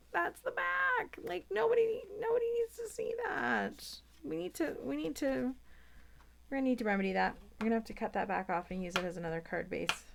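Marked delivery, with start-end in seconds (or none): 0.1-5.5 high squeaky mock horror; much higher squeak of pretend disgust. Real, not acted. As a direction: squeak it out in fake dismay. Mock horror at card back.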